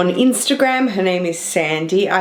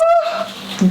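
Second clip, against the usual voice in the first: gasp. Real, not acted. Opening a very heavy gift hamper.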